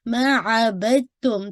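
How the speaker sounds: मा अबदतुम said slowly, the dal merged into ta; slow recitation demo. Shown as a demonstration. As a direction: say अबदतुम with a ta sound. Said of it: "ता पढ़ रहे होते हैं".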